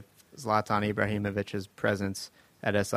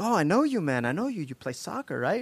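higher, eager stranger voice; excited fan; 8 semitones higher than usual. Retold or imitated. Lobby stranger recognizing a soccer star, eager higher voice. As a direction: play the starstruck lobby guy. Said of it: "was like".

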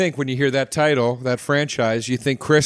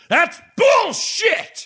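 slow, high mock outburst; a hammed-up furious dad, drawn out high; angry outburst; much slower than usual. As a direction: blurt it out as an outraged dad, hammed-up. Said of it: "Really gets really angry".

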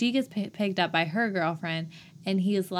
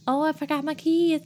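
high-pitched Southern belle voice; 8 semitones higher than usual. Acted. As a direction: play a ditzy Southern belle. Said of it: "sounds like a Southern belle".

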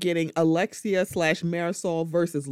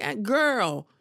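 teasing imitation; 7 semitones higher, slower than usual. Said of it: "It's like".

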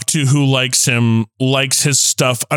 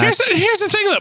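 excited; faster than usual. Real, not acted. Excited jump in to argue.

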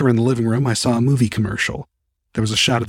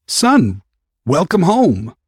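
louder, higher fatherly greeting; dad welcoming; 6 semitones higher, 5 dB louder, much slower than usual. Acted. Father greets his son at the door, loud glad welcome. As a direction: welcome your son home with joy. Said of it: "my dad said in Lao".

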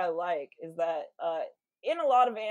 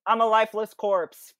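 mock lament; 4 semitones higher, 8 dB louder than usual. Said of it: "He's just like".

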